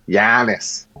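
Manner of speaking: Giannis in a broad Australian accent. Shown as a demonstration. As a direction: say Giannis like an Aussie. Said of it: "in an Australian accent".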